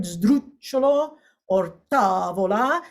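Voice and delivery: "sdrucciolo" and "tavola" stressed on their first syllables; stress demo. Shown as a demonstration. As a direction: model "sdrucciolo" and "tavola", two unstressed syllables after the stress. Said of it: "stressed syllable followed by two unstressed syllables".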